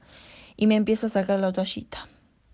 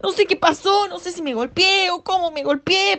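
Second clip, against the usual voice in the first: panicked calling; 9 semitones higher than usual. Retold or imitated. Girl to her mother after seeing blood, frantic high cries. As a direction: burst in calling mom in a panic.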